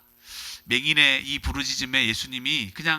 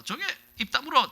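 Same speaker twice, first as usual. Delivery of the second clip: mock rebuke; 10 semitones higher than usual. Crowd rebuke repeated.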